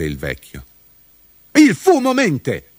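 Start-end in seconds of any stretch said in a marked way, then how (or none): 1.5-2.7 high, slow defiant cry; shouting. A narrator's reading. Mudube accuses the smoke of lying, shouting. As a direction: cry it out in outrage. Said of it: "gridò Mudube".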